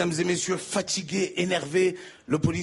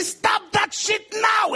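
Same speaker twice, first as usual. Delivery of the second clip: furious guard; 12 semitones higher, much slower than usual. Acted. Guard losing his temper with the comic, furious slow yell. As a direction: roar the order.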